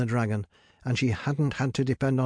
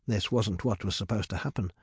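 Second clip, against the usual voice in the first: usual speaking voice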